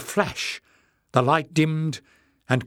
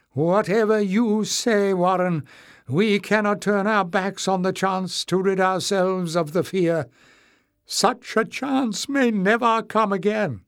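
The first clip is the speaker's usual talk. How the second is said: high, raised, insistent voice; louder, resolute; 8 semitones higher, 3 dB louder than usual. A narrator's reading. Knowles addressing Warren as heads turn, high and louder. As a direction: lift the voice and insist. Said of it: "continued more loudly".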